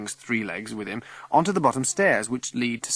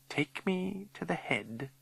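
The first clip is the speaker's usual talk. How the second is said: soft, slow, higher-pitched command; menacing old lady; 7 semitones higher, 10 dB quieter, slower than usual. A narrator's reading. Old lady fixing Figgy with her eyes, quiet menace. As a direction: demand it quietly and very slowly.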